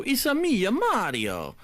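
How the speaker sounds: slow, high mock Italian accent. Streamer quoting his Italian bit to fans, in a slow hammed-up Italian accent. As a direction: ham up a Mario-style Italian accent. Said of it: "my Italian accent".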